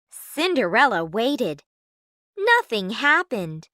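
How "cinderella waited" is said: plain reading voice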